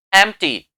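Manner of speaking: "एम्प्टी" in two syllables, em-tee; correct pronunciation. Shown as a demonstration. Teacher repeating the right way, p left silent. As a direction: drop the p from "एम्प्टी". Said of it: "ऐसे बोलते हैं इसे".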